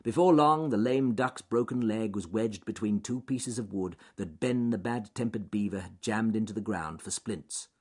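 plain reading voice

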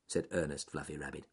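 ordinary delivery, nothing marked

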